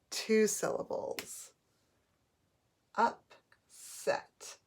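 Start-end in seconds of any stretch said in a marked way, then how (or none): 2.9-4.6 "Upset" broken into Up, pause, set; syllable by syllable. Shown as a demonstration. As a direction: separate the two syllables of "Upset". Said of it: "both closed syllables".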